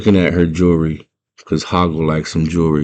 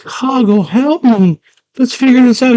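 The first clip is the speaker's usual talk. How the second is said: put-on high girlish plea, rising louder; pleading Sarah; 3 dB louder than usual. Sarah begs the fleeing Hoggle for help, high pleading voice. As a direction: call after him in a high begging voice.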